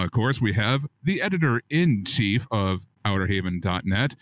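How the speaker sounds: usual speaking voice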